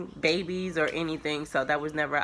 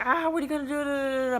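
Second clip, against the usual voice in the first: mock excited scream; 8 semitones higher, faster than usual. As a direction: imitate an excited sister's scream, trailing into da-da-da. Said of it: "she, like, screaming".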